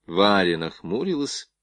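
ordinary delivery

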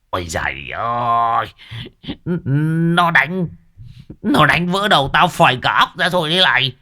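long groan rising into high, hurried wail; wailing cry. A narrator's reading. Old master clutching his head over mango pulp, panicked wailing. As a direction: howl in exaggerated agony, stammering upward. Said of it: "mà gào lên".

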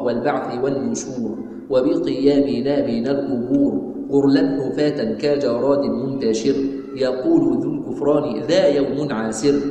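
measured, drawn-out chanting of the poem; slow verse recitation. Acted. Teacher reciting the creed poem to students, slow chant. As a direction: give each line of the poem its full slow weight.

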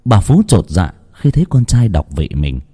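plain reading voice

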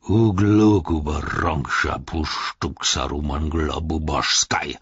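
slow whispered orc-tongue rant; orcish curse. A narrator's reading. Orc cursing the Isengarders in his own tongue, whispered. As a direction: hiss foreign curses under breath. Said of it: "zornige Rede in seiner Muttersprache".

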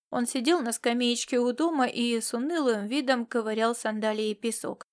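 usual speaking voice

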